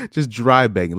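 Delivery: laughing. Real, not acted. Commenting on the girl's messages, laughing. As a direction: laugh it off in disbelief.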